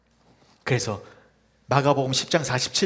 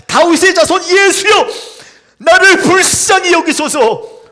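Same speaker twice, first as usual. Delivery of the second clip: louder cry; 13 semitones higher, 13 dB louder than usual. Acted. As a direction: cry out even louder despite the crowd. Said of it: "더 크게 소리를 질렀습니다". (꾸짖음에도 더 크게 외치는 절규)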